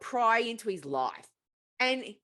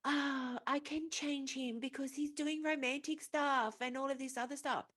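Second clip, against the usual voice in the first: softer, mock-lovestruck murmur; half-hearted lovestruck sigh, softer; mock dreamy swoon; 3 semitones higher, 9 dB quieter than usual. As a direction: coo it like she's already smitten. Mock swooning girl quote.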